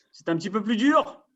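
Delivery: normal talking voice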